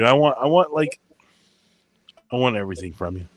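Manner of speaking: usual speaking voice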